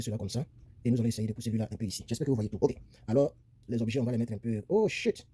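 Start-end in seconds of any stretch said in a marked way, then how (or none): 4.7-5.3 higher-pitched "oops" reaction; dismayed exclamation. Real, not acted. Teacher fumbles the objects on the board, sudden dismay. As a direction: blurt it out at a sudden slip. Slip while moving things on screen.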